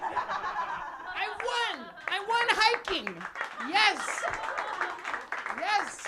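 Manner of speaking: loud, high victory whoops; cheering about winning the hike; triumphant cheering. Real, not acted. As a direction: celebrate like you just won. Triumphant celebration.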